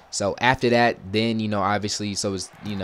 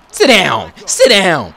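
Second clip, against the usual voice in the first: loud, high celebratory yell; excited shout; 9 semitones higher, 12 dB louder, much slower than usual. Real, not acted. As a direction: cheer the sack loud. Celebrating defensive stop.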